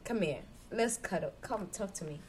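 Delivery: soft, coaxing lover's tone, acted; soothing coaxing. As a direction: gently draw a sulking partner in. Acted soothing of a sulking partner.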